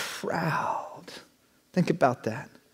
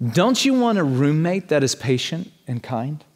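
usual speaking voice

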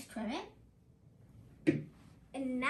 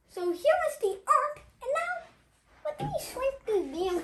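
excited presenter; 8 semitones higher than usual. Shown as a demonstration. Teacher presenting his Earth drawing to the class, excited showman. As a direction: present it like a big reveal.

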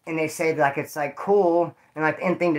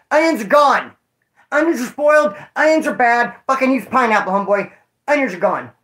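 raised, louder kitchen declaration; emphatic mock refusal; 6 semitones higher, 5 dB louder than usual. Kitchen stocker dismissing the onions to the cook, emphatic and raised. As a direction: call it out high and firm.

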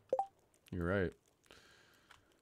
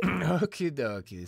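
higher, slow playful echo; mock sing-song; 7 semitones higher, 4 dB louder, slower than usual. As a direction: parrot it back sing-song. Echoing friend mockingly.

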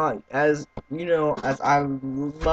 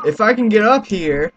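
louder, higher, urgent; shouting while fighting to climb up; straining shout; 6 semitones higher, 8 dB louder than usual. Real, not acted. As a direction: push the words out as you strain to climb.

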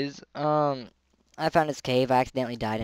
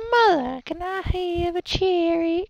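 put-on pleading child; 5 semitones higher than usual. Asking mother for a cherry.

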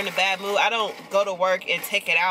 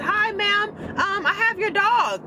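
much higher, polite stranger on the phone; caller's voice; 12 semitones higher, slower than usual. Retold or imitated. Retelling the stranger who called about her dog, high polite voice. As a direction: lift way up into the caller's sweet phone voice.

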